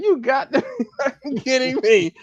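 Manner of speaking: high, giggling you got to be kidding me; laughing disbelief. Retold or imitated. To the man at the door, laughing in disbelief. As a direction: break up laughing mid-line.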